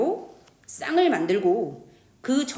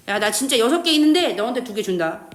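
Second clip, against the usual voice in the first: loud acted bargaining; emphatic offer; 7 dB louder than usual. Oxygen atom offering its friend two electrons, loud and emphatic. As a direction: announce the generous offer loudly.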